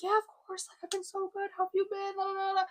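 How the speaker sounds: high, put-on breezy reply; fake-okay spiel. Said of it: "the whole spiel that I do to everyone".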